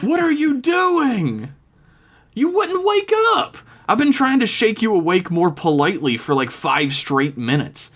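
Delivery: put-on high, anxious young woman; worried friend. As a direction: fret over her friend. Friend voiced in story.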